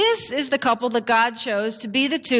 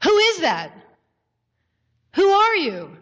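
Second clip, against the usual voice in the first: high-pitched, urgent questioning; hanging man's raised, anxious voice; man in peril; 6 semitones higher than usual. Acted. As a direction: call up high to an unseen stranger. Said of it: "So he says".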